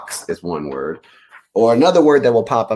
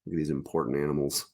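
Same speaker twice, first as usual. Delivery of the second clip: ordinary delivery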